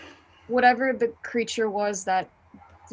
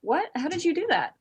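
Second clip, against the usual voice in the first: amazed; 8 semitones higher than usual. Acted. Stunned by the healing.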